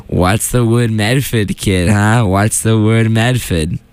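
put-on hammy Boston accent catchphrase. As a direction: drawl it like a Medford local. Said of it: "I do the Boston accent with it too".

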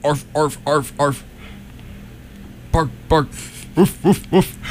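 high barking dog imitation; dog barks. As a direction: bark like a small puppy. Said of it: "and sound effects".

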